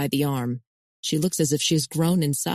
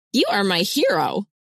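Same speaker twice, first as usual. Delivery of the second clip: excited; 4 semitones higher, 3 dB louder than usual. Acted. Excited hug greeting.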